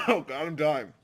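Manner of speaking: real coughing fit, choking on food. Real, not acted. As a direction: cough hard, then gasp out the words. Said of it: "I'll choke and I'll die".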